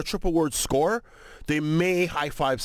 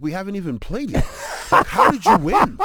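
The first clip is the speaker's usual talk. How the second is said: acted protest, rising higher and louder; mock disbelief; 5 semitones higher, 7 dB louder than usual. Players to a man claiming victory before play starts, mock disbelief. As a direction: challenge the bragger in mock bafflement.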